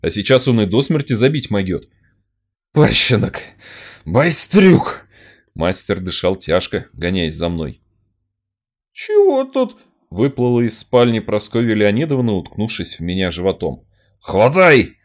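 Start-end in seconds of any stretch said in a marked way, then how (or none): 2.7-5.1 higher, louder furious yelling; angry shout. Acted. Master chasing, shouting insults. 9.0-9.8 high startled question; surprised. Acted. Mistress bursts in. 14.2-15.0 loud, sharp command to grab; shouting. Acted. Mistress orders grab him.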